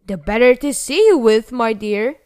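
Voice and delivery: loud, eager disguised-wolf voice; wolf posing as grandma. A narrator's reading. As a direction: boom it out as the fake granny.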